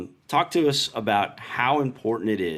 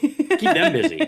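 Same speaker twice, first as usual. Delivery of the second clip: playful squeal; 5 dB louder, slower than usual. Real, not acted. Joking, echoing the phrase.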